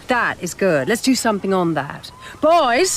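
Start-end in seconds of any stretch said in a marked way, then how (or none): 2.4-3.0 calling out. Acted. Director calling her crew.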